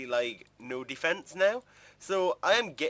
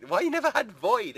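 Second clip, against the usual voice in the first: higher, louder jeering question; mocking ridicule; 9 semitones higher, 6 dB louder than usual. Other players taunting him, imitated jeer. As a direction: mimic the teasing players.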